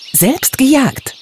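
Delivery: high, slow, boastful "gejagt"; proud little fairy boasting. Acted. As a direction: brag about your own catch. Fairy boasting of her hunt.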